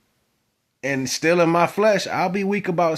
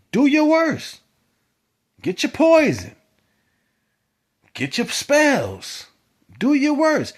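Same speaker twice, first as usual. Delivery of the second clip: defiant taunt; 12 semitones higher, slower than usual. Real, not acted. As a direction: dare them, voice up and ringing. Taunting enemies to try harm.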